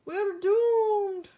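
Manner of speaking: high, loud witch shriek of doom; witch crying out. Acted. A panicked witch on the runaway train cries out, high and loud. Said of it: "cried a witch".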